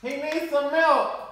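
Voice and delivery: mock baby voice. Teasing the suffering eater.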